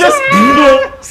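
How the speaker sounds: high shrieking laugh; loud laugh. Real, not acted. Bursts out laughing.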